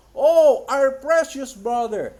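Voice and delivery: loud, high outburst on the Oh; imitated screaming. Young people grabbing their precious brother, imitated scream. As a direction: cry out high, then draw out the rest. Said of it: "and screaming".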